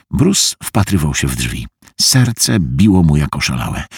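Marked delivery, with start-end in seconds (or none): none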